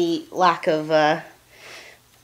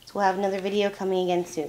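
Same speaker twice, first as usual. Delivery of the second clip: normal talking voice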